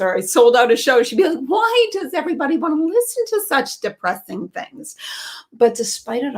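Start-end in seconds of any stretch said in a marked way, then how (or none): 1.5-5.0 higher, lightly put-on voice of an incredulous mother; mock exasperated mother. Comedian voicing her mother puzzled by her success. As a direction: whine it like a baffled mom.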